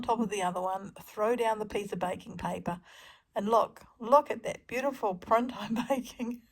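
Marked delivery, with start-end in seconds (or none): 3.3-6.4 laughing through the words; giggling as she admires the print; delighted laugh over a beautiful print. Real, not acted.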